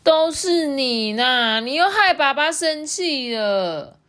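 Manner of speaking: high, loud, drawn-out blaming; 埋怨的小妹妹. A narrator's reading. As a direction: wail accusingly at your brother, stretching words. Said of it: "象妹妹就说". (妹妹拖长音高声怪哥哥)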